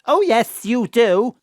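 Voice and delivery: loud, high, hammed-up panto shout; panto call-back. Mam answering Stakis's panto heckle, loud and hammy. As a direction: belt it back like a panto audience.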